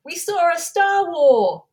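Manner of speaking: high, drawn-out triumphant yell; loud, stretched re-enacted holler; comedian yelling out. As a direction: shout it slowly to the whole pub. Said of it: "a comedian there just yelled out".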